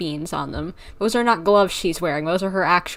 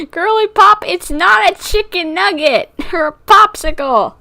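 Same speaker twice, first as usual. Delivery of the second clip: squealing scold; 9 semitones higher, 8 dB louder than usual. Real, not acted. Repeats scolding, higher and louder.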